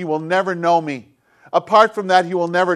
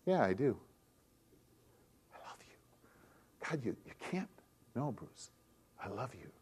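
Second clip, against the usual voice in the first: soft, lowered voice, playing both caller and God; hushed acted dialogue; 6 semitones lower, 11 dB quieter, faster than usual. Pastor acting out a phone call between Bruce and God, soft and hushed. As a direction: let the call fall to a near whisper.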